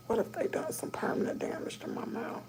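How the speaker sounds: soft frightened whisper; anxious whisper waiting for the dentist; worried. Real, not acted. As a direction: murmur the fear under your breath.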